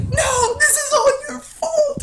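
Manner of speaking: loud, high wailing accusation; squealed, drawn-out imitation of bawling; mock crying. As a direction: blame him in a hammed-up sobbing shriek. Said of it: "He's crying".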